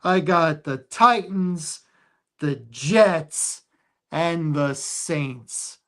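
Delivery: ordinary talk, nothing marked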